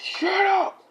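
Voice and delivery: high, strained yell; mock furious shout. Midoriya to classmate while choking her, mock furious shout.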